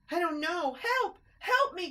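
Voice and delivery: high, loud panicked cries; cry for help. A narrator's reading. Christopher swept by the flood, loud panicked cries. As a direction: scream for help as the water takes you. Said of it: "cried Christopher".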